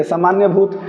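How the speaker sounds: usual speaking voice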